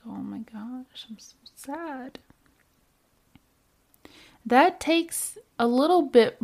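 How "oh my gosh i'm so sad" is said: whispered, exaggerated self-pity; breathy put-on sadness, very soft; hammed-up whispered sadness; mock sad whisper.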